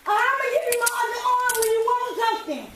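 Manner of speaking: high, loud defiant shout; defiant yell. Real, not acted. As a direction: snap back in angry defiance. Defiant while being cuffed.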